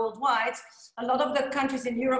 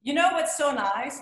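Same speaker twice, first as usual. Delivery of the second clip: ordinary delivery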